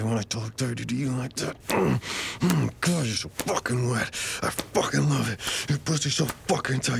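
aroused. Acted. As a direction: climb in pitch with the heat. Mounting arousal.

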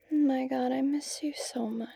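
soft, hushed whisper into a hug; tender whisper. Acted. Hugging her best friend, tender whisper.

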